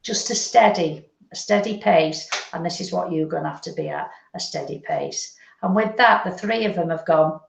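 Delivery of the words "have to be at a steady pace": whispered. Shown as a demonstration. Medium whispers the advice to the sitter, hushed. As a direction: drop to a whisper.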